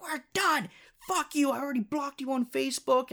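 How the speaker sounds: high-pitched imitation of a furious girl; mock angry screech. Girl cutting him off after refusing the ride, mock angry screech. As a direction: squeal the rejection without much volume.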